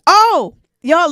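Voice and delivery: usual speaking voice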